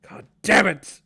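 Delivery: loud, high curse; dwarf father bursting out in loud anger; frustrated outburst. Acted.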